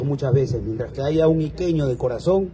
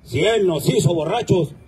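toast recitation; 4 semitones higher, 3 dB louder than usual. Acted.

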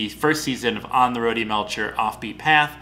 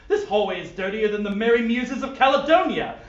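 fussy, disgusted posh gentleman; 9 semitones higher than usual. Acted. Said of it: "yet posh voice".

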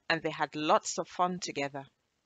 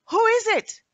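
calling out; 12 dB louder than usual. Acted. Puzzled girl calls out.

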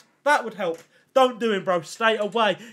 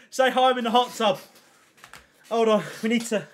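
ordinary delivery

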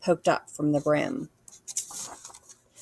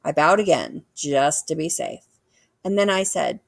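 ordinary delivery

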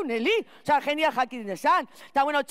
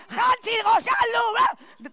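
mock yell at child; 8 semitones higher, much slower than usual. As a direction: bellow the boy's name like a frazzled mum at eight a.m. Morning yelling at the child. (grito lento y agudo llamando al niño)

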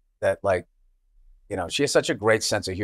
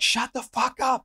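higher mock yell at the sat-nav; retold yell; 12 semitones higher, 3 dB quieter than usual. Host retelling yelling at the map voice, mock yell. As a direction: snap it at the machine. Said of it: "I yelled".

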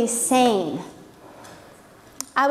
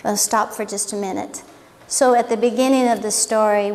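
usual speaking voice

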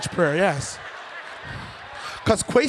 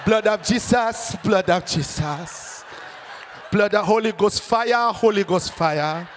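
chanted Ghanaian prayer warm-up; fervent Ghanaian call, falling on each repeat; Kwesi from Ghana; slower than usual. Retold or imitated. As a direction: intone it slowly in a Ghanaian accent. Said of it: "He had to warm them up".

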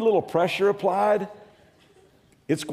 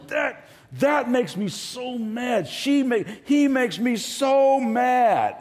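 higher, slow mock complaint; mock angry whine; 8 semitones higher, much slower than usual. Preacher imitating an angry person blaming others. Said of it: "we express our anger going".

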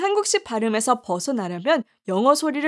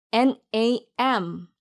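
1 spelled slowly; much slower than usual. Shown as a demonstration. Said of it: "길게 발음하세요".

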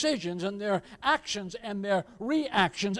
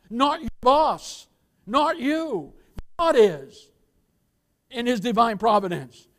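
preaching at full force; 5 dB louder than usual. Acted.

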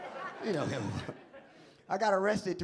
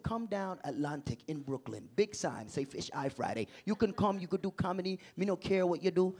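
lower, softer Jamaican patois; Jamaican accent; 3 semitones lower, 5 dB quieter than usual. Retold or imitated. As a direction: imitate a casual Jamaican promoter. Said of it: "messing with some Jamaicans".

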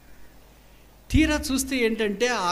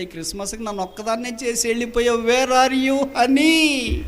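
grieving cry; 6 semitones higher than usual. Acted. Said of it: "చాలా బాధతో ఉంది".

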